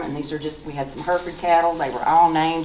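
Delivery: usual speaking voice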